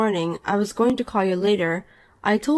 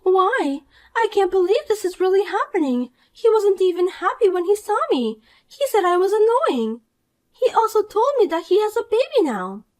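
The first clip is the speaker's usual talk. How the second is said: put-on higher, whiny young woman, hurt; Poppy, upset; 11 semitones higher than usual. Rejected Poppy whines to the wife, higher and wounded. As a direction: complain like a spoiled brat who got rejected.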